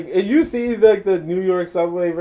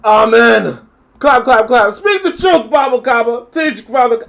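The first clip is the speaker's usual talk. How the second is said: loud, raised-pitch hammed-up amens; shouted mock call-and-response; mock congregation cheering; 4 semitones higher, 9 dB louder, slower than usual. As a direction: egg on the preacher, mockingly. Mock cheering on the preacher-like speaker.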